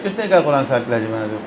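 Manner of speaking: quoting the imams with a quick, soft laugh; amused mock disbelief; laughing scoff. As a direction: laugh off the claim while quoting it. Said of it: "انہوں نے کہا".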